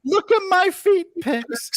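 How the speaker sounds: high, slow falsetto tease; mock girl voice. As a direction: squeal it like a girl. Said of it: "Oh, pics from Dave".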